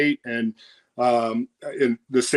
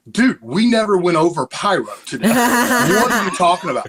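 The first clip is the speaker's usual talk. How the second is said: higher, louder bewildered protest; incredulous outburst; 6 semitones higher, 4 dB louder than usual. Retold or imitated. Wrestler retells asking the pyro guy what's going on, incredulous and loud. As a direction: act baffled, nobody told you. Retold confusion over surprise pyro.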